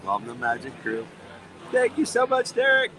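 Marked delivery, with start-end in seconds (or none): 1.7-2.9 high, loud, delighted thank-you; excited thanks. Real, not acted. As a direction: gush your thanks. Thanking a tipper on stream.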